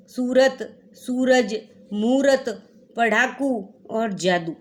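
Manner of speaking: usual speaking voice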